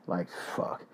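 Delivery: breathed out, nearly silent like fuck; whispered curse. Real, not acted. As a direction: hiss it under the breath. Fuming that the thief got off.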